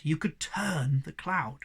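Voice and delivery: plain reading voice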